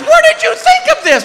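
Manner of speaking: very high shouted demand; incredulous wife. Retold or imitated. Wife questioning husband about his costume, incredulous. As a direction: demand it in mock-wife outrage.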